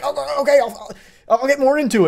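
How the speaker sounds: high-pitched, stammering imitation of a shocked man; mock panicked compliance. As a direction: blurt it out in pretend panic, voice jumping up. Acts the shocked man giving in.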